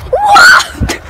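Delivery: high shocked yelp of surprise; startled shout. Real, not acted. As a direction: blurt it out in shock. Startled during the dog's jump.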